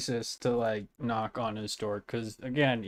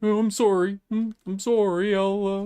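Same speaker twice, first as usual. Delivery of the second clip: high, louder mock-sorry whimper; pitched-up mocking "I'm sorry"; mock apology; 8 semitones higher, 6 dB louder than usual. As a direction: ham up a meek Canadian apology. Imitating spit video apology.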